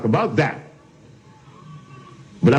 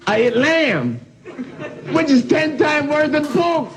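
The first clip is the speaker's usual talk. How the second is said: laughing; 10 semitones higher than usual. Real, not acted. Laughing through the joke.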